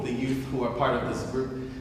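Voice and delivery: usual speaking voice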